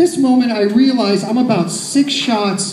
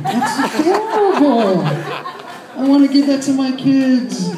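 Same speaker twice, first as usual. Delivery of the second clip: mock adoring; 5 semitones higher, slower than usual. Real, not acted. Teasing snorting audience member.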